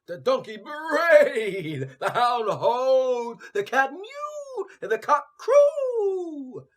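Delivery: loud, high and slow, stretched out; building animal chorus. A narrator's reading. Narrator calling out the animals' chorus, loud and slow. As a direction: belt each animal's cry.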